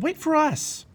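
high, loud call; yelling. A narrator's reading. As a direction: call out for them to wait. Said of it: "they yelled".

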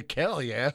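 "Cal yeah" with lips still, K for P; ventriloquist cal yeah. Shown as a demonstration. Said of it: "you can say".

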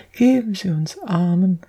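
high begging whine; pleading wail. A narrator's reading. Little girl begs strangers for the poor, wailing. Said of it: "unsicherer Stimme zu jammern".

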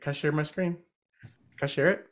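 ordinary delivery

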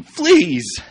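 high, loud cry of Fleas; alarmed cry; cried out. A narrator's reading. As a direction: shriek the word in disgust. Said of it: "I cried".

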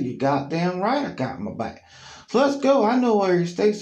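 high, loud fired-up yes; pumped-up agreement. Retold or imitated. Tim agreeing to go get revenge, high loud hype. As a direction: get hyped for the fight.

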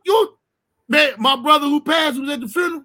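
ordinary delivery

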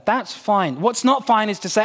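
normal talking voice

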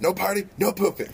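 squeaky high joke line; high silly voice. Acted. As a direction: pitch it up, silly. Joking retort.

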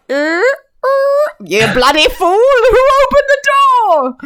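high, creaky mock squawk, stretched out at the end; duck mimicking a door. Host imitating the talking duck at the door, high squawking mimicry. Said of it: "sound of a door opening and closing".

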